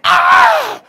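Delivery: high, loud startled cry; hammed-up yelp of alarm; mock alarmed shout. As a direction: play the horrified outsider for laughs. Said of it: "they're just like".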